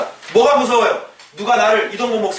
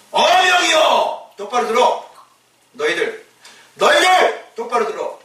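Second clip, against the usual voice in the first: royal decree; 4 semitones higher than usual. Retold or imitated. Preacher likens Peter's words to a king's herald, proclaiming. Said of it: "뭐같이 들려?".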